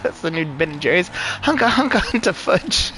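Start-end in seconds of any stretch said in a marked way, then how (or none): 1.1-2.9 high, silly Aussie delivery; butchered Australian accent, drawn out and high; mock Australian-English accent. Riffing on a new ice cream name, high mock Australian accent. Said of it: "butchering a fucking Australian or English accent".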